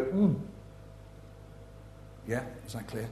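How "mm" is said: "mm" hummed with no vowel after; just hum "mm"; pure consonant sound. Shown as a demonstration. Showing children a clean sound, "mm" hummed. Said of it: "We go".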